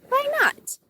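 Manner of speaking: high-pitched, playful "why not"; retold breezy thought. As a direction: toss it up high like a sudden idea. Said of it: "And I thought".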